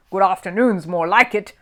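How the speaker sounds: curt, sour surgeon; barked. A narrator's reading. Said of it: "barked Sir Archibald".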